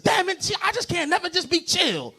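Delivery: high-pitched comic exasperation; exasperated father. Acted. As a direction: play a fed-up dad whining in protest.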